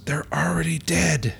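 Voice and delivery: slow deadpan whisper. Acted. Glasses dude telling Nestor the zombies' state, slow whisper. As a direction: breathe the line slowly like a grim secret. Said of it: "the dude with the three D glasses goes".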